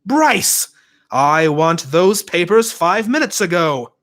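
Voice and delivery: loud, high barked order; booming. A narrator's reading. Dad ordering Bryce to hurry, loud booming order. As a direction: boom across the room. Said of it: "My dad boomed".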